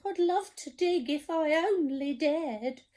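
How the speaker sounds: high, trembling scared cook voice. Acted. Storyteller voicing the cook, scared of the sea, high voice. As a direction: quiver like a frightened wimp. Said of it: "the cook declared".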